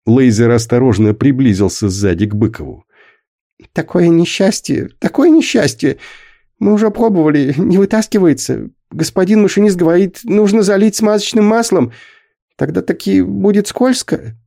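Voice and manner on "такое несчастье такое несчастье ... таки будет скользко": much higher, anxious lamenting voice; fretting old man. A narrator's reading. Leizer frets to Bykov about the stuck boy, anxious high lament. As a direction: wring your hands over the misfortune.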